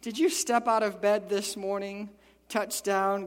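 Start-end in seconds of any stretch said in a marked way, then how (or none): none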